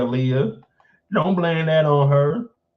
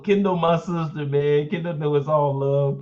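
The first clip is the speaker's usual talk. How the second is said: normal talking voice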